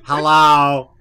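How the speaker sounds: loud hello into a bad line; three-way phone call. Real, not acted. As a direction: call out to check they're still there. Call connection dropping out.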